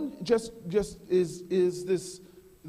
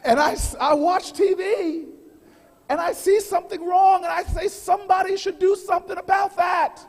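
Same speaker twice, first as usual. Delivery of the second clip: preaching build; 8 semitones higher, 8 dB louder than usual. Acted. Speaker rallying the audience, full-force preacher's cry. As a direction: preach it to the crowd, rising. Rousing speech opening.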